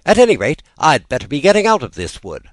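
high, loud, bravely cheerful; Alice putting on a cheerful front, high and loud; forced cheer. A narrator's reading. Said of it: "went on as cheerfully as she could".

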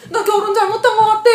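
high, loud mock wail; retold bawling. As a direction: bawl it out in hammed-up tears. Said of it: "막 이러면서 막 엉엉 울면서".

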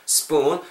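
"spoon" with the oo drawn out long; long vowel. Shown as a demonstration. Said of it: "좀 길게 빼주세요".

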